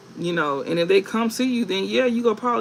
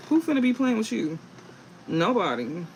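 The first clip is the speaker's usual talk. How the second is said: ordinary talk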